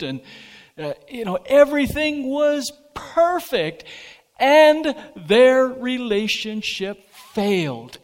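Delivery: full-force preaching. Real, not acted. Pastor tells married couples of Eden, in rising fervent preaching. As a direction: preach it out, climbing to a peak.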